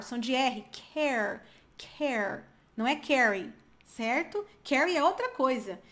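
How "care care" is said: CARE ending on R, silent E; final R sound. Shown as a demonstration. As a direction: finish "care" on the R with no vowel after it.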